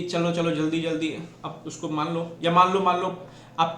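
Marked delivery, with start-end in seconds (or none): none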